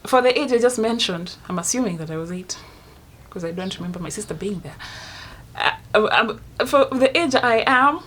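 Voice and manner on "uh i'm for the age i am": higher, slow and frightened; a scared little girl's voice. Retold or imitated. Narrator recalling being a frightened child, slow and scared. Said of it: "I'm scared".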